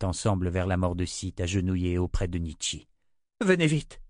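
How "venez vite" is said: higher, pressing urgency; urgent call to come quickly. Acted. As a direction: hurry them over. Kara urges them to hurry.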